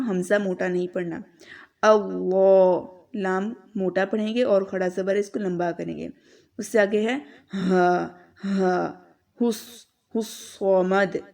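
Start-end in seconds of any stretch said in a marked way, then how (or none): 1.9-2.9 अल्लाह with a thick, full lam and long stretched a; heavy lam. Shown as a demonstration. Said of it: "लाम मोटा पढ़ी हूँ". 9.4-11.2 हुस्समद with a thick, emphatic स्वाद; slow, weighty s in हुस्समद; heavy swad. Shown as a demonstration. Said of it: "स्वाद बहुत मोटा पढ़ेंगे".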